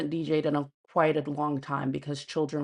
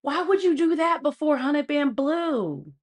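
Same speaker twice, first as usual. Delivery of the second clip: exasperated; 10 semitones higher, 5 dB louder than usual. Real, not acted. Incredulous complaint.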